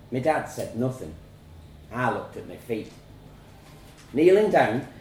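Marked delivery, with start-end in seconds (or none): none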